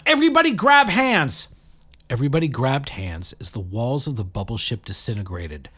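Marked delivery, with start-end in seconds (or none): 0.0-1.5 high, loud urgent command; screamed order. A narrator's reading. Saradwan commanding everyone to hold hands, high loud screaming. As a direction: bark the order over the chaos. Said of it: "screamed Saradwan".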